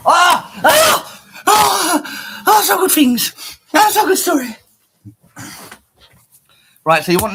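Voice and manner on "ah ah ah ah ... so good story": high, loud, slow mock ecstatic cries; hammed-up shouts of delight. Streamer yelling with fake joy at a find. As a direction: squeal it out like a cartoon fan.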